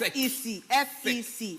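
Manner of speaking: F E C spelled letter by letter. Shown as a demonstration. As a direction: spell out F E C slowly and clearly. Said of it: "just do one like this".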